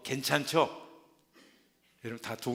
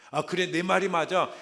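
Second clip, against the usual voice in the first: loud, much louder mock agreement with 말이 stressed; hammed-up agreement; 5 semitones higher, 10 dB louder than usual. Believer swallowing Satan's lie, hammed-up loud agreement. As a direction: proclaim 말이 loud and falling.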